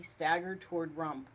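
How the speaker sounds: usual speaking voice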